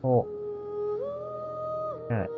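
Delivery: ordinary delivery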